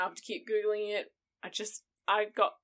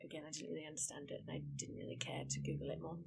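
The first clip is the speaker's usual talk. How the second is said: whispered aside; 7 semitones lower, 13 dB quieter, much faster than usual. Real, not acted. Aside muttered under her breath.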